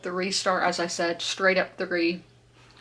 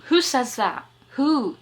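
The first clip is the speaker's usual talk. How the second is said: incredulous; 6 semitones higher, 5 dB louder, slower than usual. Real, not acted. Incredulous at odd dialogue.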